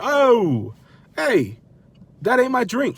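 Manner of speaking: put-on high, indignant Jersey accent; comic Jersey outburst; Jersey protest. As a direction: object like a riled Jersey guy. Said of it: "I became from Jersey at that point".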